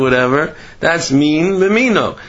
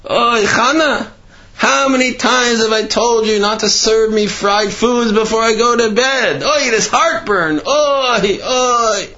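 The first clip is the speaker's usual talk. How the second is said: higher, groaning complaint to wife; 8 semitones higher than usual. Retold or imitated. Innkeeper moaning to his wife about fried food, groaning. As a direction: moan it like a man with heartburn. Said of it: "the man's groaning and groaning".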